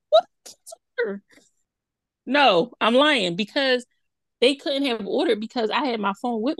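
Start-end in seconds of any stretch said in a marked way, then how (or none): 0.1-1.2 high shocked cry; shocked what. Retold or imitated. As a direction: yelp it out in disbelief. Retold shock at DoorDash charge.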